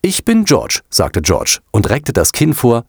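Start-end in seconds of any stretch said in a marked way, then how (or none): 0.0-0.8 high, defiant self-introduction; defiant annoyance. A narrator's reading. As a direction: announce yourself with chin thrust out. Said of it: "wenn er sich ärgerte". (trotzig und verärgert, mit hoher Stimme)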